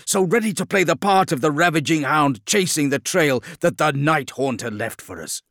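loud, high self-reproaching outburst; overwhelmed by emotion. A narrator's reading. The Lion realising he was lured away, overwhelmed with anger. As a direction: let anger at yourself pour out at full voice. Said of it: "overwhelmed by emotion".

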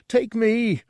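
very high, loud, eager plea; excited. A narrator's reading. Guard pleads to join the trip, excited. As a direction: beg to go, bursting with eagerness. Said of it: "bounced up and down excitedly".

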